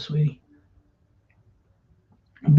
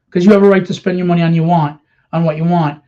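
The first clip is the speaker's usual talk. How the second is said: ordinary talk, nothing marked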